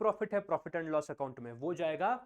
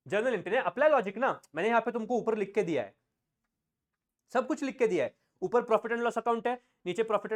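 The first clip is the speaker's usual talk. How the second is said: exasperated; 5 semitones higher, 5 dB louder, faster than usual. Real, not acted. Teacher scolds class, exasperated.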